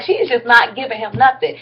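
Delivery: usual speaking voice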